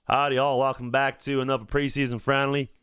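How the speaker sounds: mock Alabama Southern accent. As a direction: welcome viewers like a put-on Southerner. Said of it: "The Southern one was good".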